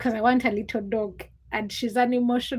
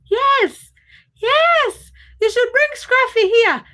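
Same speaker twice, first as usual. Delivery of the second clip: much higher and louder, squealing with delight; excited high squeal; 11 semitones higher, 7 dB louder, slower than usual. Real, not acted. Begging chat to bring Scruffy.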